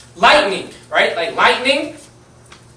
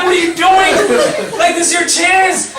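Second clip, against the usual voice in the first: much higher, louder disbelief; exasperated yell; 11 semitones higher, 6 dB louder than usual. Retold or imitated. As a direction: shout at him to seize his chance. Friends yelling at the big guy.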